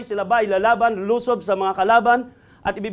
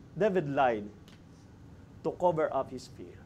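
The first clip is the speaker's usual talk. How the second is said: quiet verdict; 6 semitones lower, 10 dB quieter than usual. Real, not acted. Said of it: "What is the desperate move of David?".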